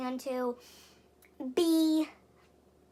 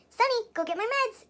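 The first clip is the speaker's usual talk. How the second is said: calling the dog; 8 semitones higher than usual. Retold or imitated. Friend calling his dog.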